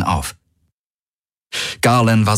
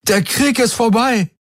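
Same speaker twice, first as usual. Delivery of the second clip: very high jubilant cry; high, triumphant yell; called out. A narrator's reading. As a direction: proclaim it to the whole crowd. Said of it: "rief er".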